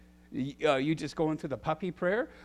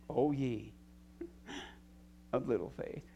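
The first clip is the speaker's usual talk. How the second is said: gentle rebuke; 5 semitones lower, 10 dB quieter than usual. Acted. Quoting Jesus rebuking.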